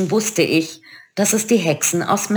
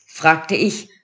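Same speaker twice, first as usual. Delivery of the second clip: plain reading voice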